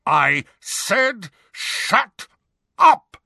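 slow, word-by-word furious roar; loud, high, drawn-out bellow. A narrator's reading. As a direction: roar it, exploding on the last word. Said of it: "roared the man".

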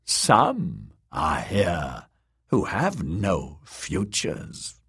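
slow, low crone mutter; terrible deep mutter. Acted. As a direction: mutter it like an ominous old witch. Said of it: "muttered in her terrible, deep voice".

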